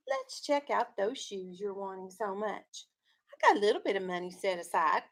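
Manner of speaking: steady read-aloud storytelling voice; story narration. A narrator's reading. Teacher reading a picture book to her class, storytelling voice.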